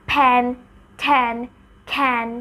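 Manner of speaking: ফ্যান ঠ্যান খ্যান with a puff of air; strong breath after the first sound of ফ্যান, ঠ্যান, খ্যান; aspirated ফ্যান ঠ্যান খ্যান; aspirated demo words. Shown as a demonstration. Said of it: "you can see এটা লড়ছে".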